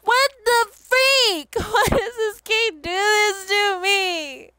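high, loud wail of gamer rage; exasperated outburst. Real, not acted. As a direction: cry out in real disbelief at the game. Player raging at game.

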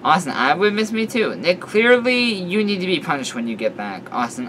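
ordinary delivery, nothing marked

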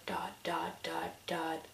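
trailing whisper. Acted. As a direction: fade the dots into a whisper. Whispering the dots.

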